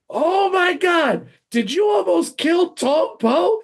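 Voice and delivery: high, slow, shouted disbelief; a director losing his mind, yelling high; imitating a frantic director. Director yelling at the actor over the real blade, shouting. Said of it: "the director loses his mind".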